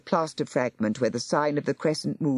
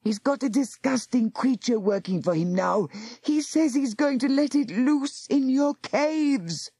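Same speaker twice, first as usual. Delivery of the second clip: urgent warning; 8 semitones higher than usual. Acted. Ben urgently warning Tar.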